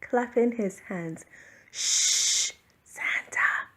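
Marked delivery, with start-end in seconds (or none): 1.7-3.7 unvoiced hushed shush; whisper. A narrator's reading. Family dog hushing Santa, whispered. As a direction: breathe the shush. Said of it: "whispered the family's dog".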